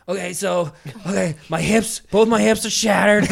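loud, high, urgent yelling; acted agonized report. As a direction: cry it out in comic agony. Acts himself answering the medics in pain.

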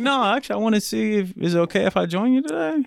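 put-on high voice for the doctor; lightly voiced doctor. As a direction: voice a doctor making a tentative request. Doctor's polite request, acted in retelling.

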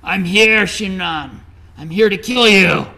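loud, high threat; shouting. Retold or imitated. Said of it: "starts shouting at him".

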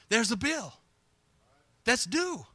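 ordinary delivery

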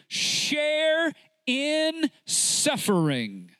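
very slow, every word drawn out; slow solemn quote. Shown as a demonstration. Preacher quoting scripture to the church, slowly. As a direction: lay the verse down slowly and heavily. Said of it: "my Bible says in Second Timothy".

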